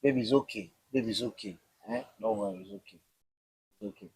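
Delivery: lightly voiced tender wife soothing her husband. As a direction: hush him gently like calming a child. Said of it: "when she's like".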